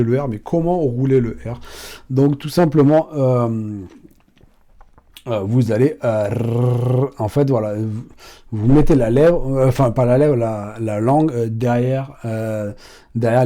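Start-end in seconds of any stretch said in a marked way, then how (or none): 6.3-7.1 a short tongue trill "rrr"; rolled r trill. Shown as a demonstration. Teaching learners how to roll the Spanish r. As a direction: roll the r behind the teeth.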